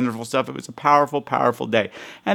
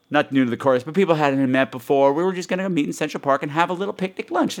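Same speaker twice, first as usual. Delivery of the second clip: usual speaking voice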